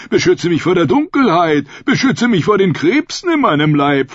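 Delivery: mock supplicants, building in urgency; imitated prayers. Many prayers imitated, pleas piling up. (nachgeahmte Gebete, immer höher und flehender)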